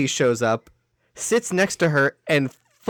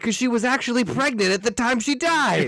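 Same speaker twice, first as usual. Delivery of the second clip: higher-pitched mock doctor; indiscreet doctor; 7 semitones higher, faster than usual. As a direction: deliver awful news with comic indiscretion. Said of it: "the doctor wasn't quiet".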